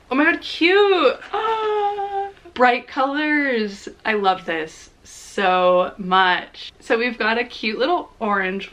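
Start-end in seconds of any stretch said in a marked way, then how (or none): 0.1-1.2 thrilled squeal. Real, not acted. Opening a gift package. 1.3-3.9 delighted. Real, not acted. Delighted by the colors.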